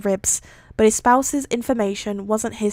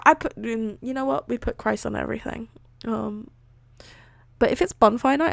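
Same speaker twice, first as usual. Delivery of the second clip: ordinary delivery, nothing marked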